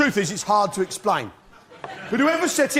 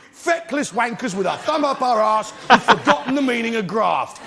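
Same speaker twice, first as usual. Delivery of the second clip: usual speaking voice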